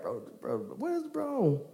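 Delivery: hushed, high mock inner voice; talking in her head. Sarah doubting God in her head, hushed high disbelief. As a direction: imitate a private thought, soft and incredulous. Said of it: "talking in her head like".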